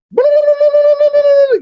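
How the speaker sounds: high-pitched rolling "blah-blah" holler; long high trilled yell, imitated; imitated Fijian yell. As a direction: mimic a rapid, high call up a hill. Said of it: "gives out this Fijian yell".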